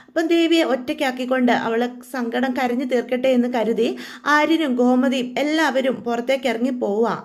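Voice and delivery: ordinary delivery, nothing marked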